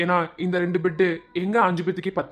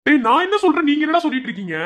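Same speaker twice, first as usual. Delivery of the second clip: raised, higher-pitched reprimand; exasperated scolding; 8 semitones higher, 3 dB louder than usual. Acted. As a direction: tell off cheeky juniors. Senior scolds joking juniors.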